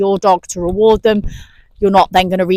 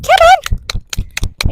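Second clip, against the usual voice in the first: much higher, eager call to the dog; excited call. Real, not acted. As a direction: urge the dog in, high and bright. Excitedly calling dog back.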